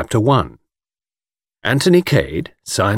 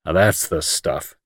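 low, slow approval; approving; 5 semitones lower, 4 dB quieter, slower than usual. A narrator's reading. Jimmy approves his friend's plan, approvingly. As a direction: give it slow, deep approval. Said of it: "said Jimmy approvingly".

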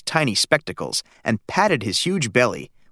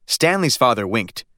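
plain reading voice

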